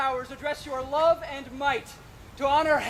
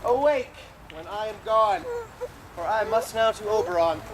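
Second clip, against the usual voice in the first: ordinary talk, nothing marked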